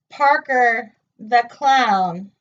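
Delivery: ordinary delivery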